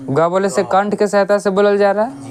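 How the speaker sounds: ordinary talk, nothing marked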